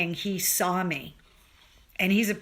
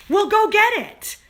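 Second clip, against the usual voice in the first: high, loud encouraging call; urging shout; 14 semitones higher, 9 dB louder than usual. Retold or imitated. Firefighter friend cheering runner toward the finish, loud high urging. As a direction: push her on to the finish.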